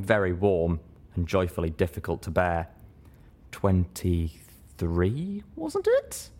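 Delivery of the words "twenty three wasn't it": sultry teasing voice. Acted. Homage teasingly counting.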